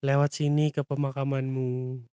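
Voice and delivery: low, hushed eerie tone; sinister invitation, soft and deep; creepy old man. Acted. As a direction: say it low and spooky, like a mysterious grandpa. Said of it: "bilang dengan nada seram".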